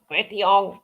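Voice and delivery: Betty Ong in a mock Chinese accent. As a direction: put on a jokey Chinese accent. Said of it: "Do you like my, my Chinese accent?".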